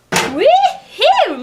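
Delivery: high, loud whoop of delight; excited whoop. Real, not acted. Revealing the finished roast to viewers, excited whoop. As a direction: cheer at the big reveal.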